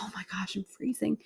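hushed whisper, trying not to wake her; breathy unvoiced whisper in the dark; whispered so the host sleeps on. Waking at night, cold.

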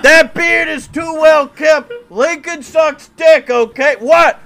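put-on very high, loud, slow heckling shout of a drunk hooligan; Irish hooligan heckler. Time-traveling hooligan heckling Lincoln at the debate, shrill shouting. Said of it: "Irish hooligans".

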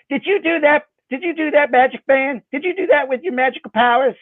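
put-on high little-girl accusing voice; a young girl squealing an accusation; high childish voice; child's voice. Child Sarah accuses magician.

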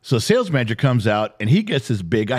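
usual speaking voice

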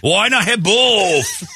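high, slow, laughing put-on voice; mock buffet diner. Host playing a greedy buffet diner, high and slow.

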